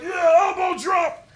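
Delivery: high, slow shouted war cry; slow, high battle cry; wrestling shout. Retold or imitated. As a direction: yell it as you leap. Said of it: "I'm like".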